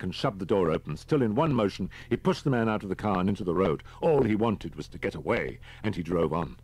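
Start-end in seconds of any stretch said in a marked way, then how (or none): none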